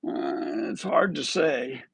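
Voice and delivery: imitating a reluctant answer after a drawn-out "uh"; low, hesitant mock "uh", then a reluctant answer; mock hesitant reply. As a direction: mimic someone struggling to reply. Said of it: "He seemed to struggle with his reply".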